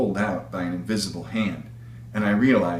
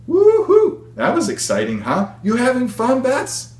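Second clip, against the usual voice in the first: high, loud whoop with a laugh in the voice; laughing excited call; 7 dB louder than usual. Acted. Pilot calling back to her passenger mid-flight, high laughing whoop. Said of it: "called from behind me with a laugh in her voice".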